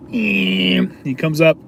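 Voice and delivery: high, sustained mock motor whine; drawn-out high vehicle noise; scooter motor imitation. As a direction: buzz like a little scooter. Imitates scooter motor before words.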